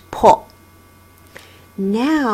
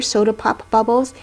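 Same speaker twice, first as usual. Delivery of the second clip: ordinary talk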